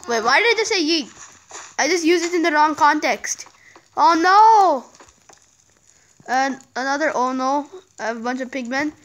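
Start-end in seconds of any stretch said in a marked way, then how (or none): 4.0-4.8 alarmed exclamation. Real, not acted. Mobs attacking in game.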